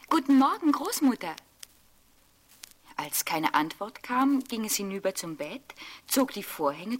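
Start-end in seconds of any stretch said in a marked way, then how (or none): 0.1-1.4 put-on louder and higher girl's call through the door; rufend. A narrator's reading. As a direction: call out cheerfully from the doorway. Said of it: "Schon an der Tür rief es". (Rotkäppchen ruft laut und hell durch die Tür)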